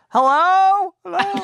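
very high call into the dark; acted lost call, second one falling away; shouted hello. Cool Cat alone in the pit's darkness, high shouted call. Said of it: "and he just shouts".

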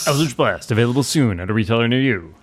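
fast, loud commercial tagline; ad announcer. Pretend. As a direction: pitch it like a TV ad. Jokes like a product ad.

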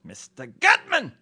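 echoing the listener's formal name for him; mock formal address. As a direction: cry the name out slow and grand.

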